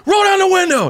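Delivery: very high mock security guard bark; mock guard order. Retelling the guard ordering the window down, very high mock bark. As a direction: squeak it out like a self-important guard.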